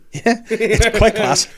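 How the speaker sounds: slow, high amused laugh. Retold or imitated. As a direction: chuckle through the words. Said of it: "And I was like".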